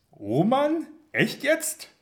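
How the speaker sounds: high, loud incredulous snort; bursting out laughing. A narrator's reading. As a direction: splutter it through a laugh. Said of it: "prustet Karl los".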